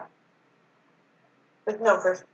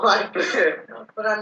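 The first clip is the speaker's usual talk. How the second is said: ordinary delivery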